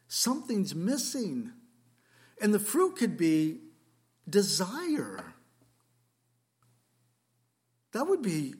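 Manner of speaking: pleading prayer voice rising to a loud, high cry; impassioned preaching. Acted. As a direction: build the sermon until you are crying it out. Preaching builds to full force.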